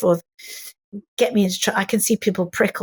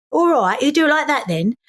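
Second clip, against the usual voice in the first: higher, chatty mock Cockney; Cockney accent; 4 semitones higher than usual. Shown as a demonstration. Actress showing how her partner does Cockney, Cockney accent. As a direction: slip into a matey Cockney accent. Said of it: "getting quite good at Cockney".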